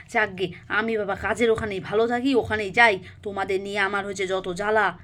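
exasperated husband. Acted. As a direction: grumble as a fed-up husband.

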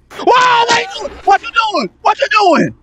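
high, shocked shouting; high startled cry of what you doing; alarmed shout. Real, not acted. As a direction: yell out in disbelief at a friend.

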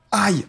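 high pained yelp; a sudden ow from aching muscles; sharp cry of pain. Real, not acted. Cries out from sore muscles.

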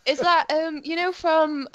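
usual speaking voice

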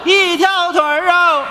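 hawker call and answer. Acted. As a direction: trade calls back and forth like market hawkers. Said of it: "call and response hawking duet".

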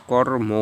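কর্ম ending in a full "o", kawr-mo; correct pronunciation. Shown as a demonstration. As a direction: draw out the final "o" of কর্ম. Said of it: "শেষে অ থাকলে ওকার".

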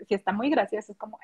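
normal talking voice

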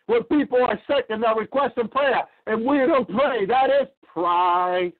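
rising hellfire sermon cadence, slamming down on pride; fiery preaching. Acted. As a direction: revival preacher hitting the refrain hard. Preaching build to crescendo.

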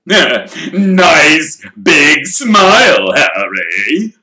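loud, beaming showman; booming and slow through a grin. Acted. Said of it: "through his own gleaming teeth".

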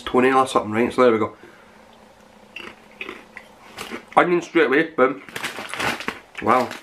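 chewing while talking; crunching food between words; mouth full of crisps. Real, not acted. Seen 2: Bites crisps at 4.5 and 11.5, chewing with closed mouth throughout.

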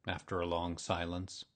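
plain reading voice

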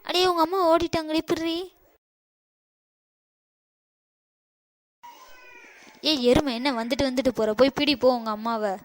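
loud angry yelling; shouting. Acted. Sister-in-law yells at the girl to catch her mother. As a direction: bark orders to chase her mother.

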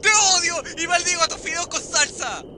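loud furious outburst after a scare; angry yell. Real, not acted. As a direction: yell it at the game. Reacción a susto. (grito furioso)

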